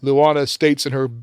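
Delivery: normal talking voice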